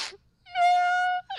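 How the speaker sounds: very high, loud tearful wail; crying. Retold or imitated. Player answering opponent's question, hammed-up crying wail. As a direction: burst into exaggerated sobs on one word. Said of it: "I just started crying in the middle".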